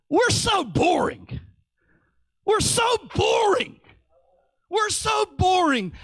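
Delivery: high-pitched mock wail; exasperated outcry. Preacher lamenting dull small talk, exasperated high cry.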